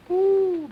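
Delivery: high squeaky crack on Ooh. Pretend. Dummy cracks mid-song, squeaky high voice crack. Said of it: "what a voice crack".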